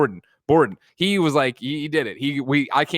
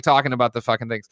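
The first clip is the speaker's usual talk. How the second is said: ordinary talk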